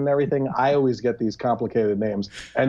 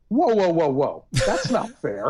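mock protest; 6 semitones higher than usual.

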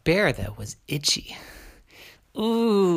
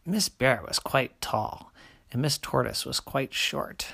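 usual speaking voice

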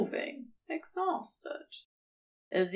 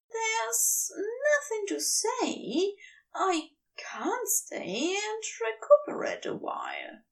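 wistful Aziraphale; 8 semitones higher than usual. Acted. Aziraphale high, slow, whisper.

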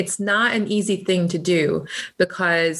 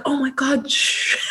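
mock hushing; 7 semitones higher than usual. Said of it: "I'm like".

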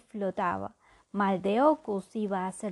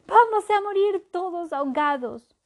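despairing wail; 9 semitones higher, 7 dB louder than usual. Acted. Despairing in the storm.

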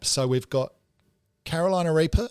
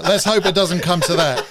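laughing; 5 semitones higher, 7 dB louder than usual. Real, not acted. Host laughing about wasted setup.

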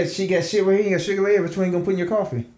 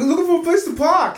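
high, loud mock Boston accent; Boston accent imitation, laughing; 14 semitones higher, 5 dB louder than usual. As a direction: tease him in a hammed-up Boston accent. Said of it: "the Boston accent always comes out of Diddy".

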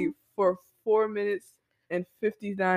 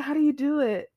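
mimicked interviewer; 6 semitones higher than usual. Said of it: "They be like".

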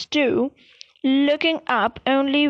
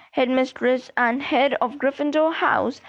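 ordinary delivery

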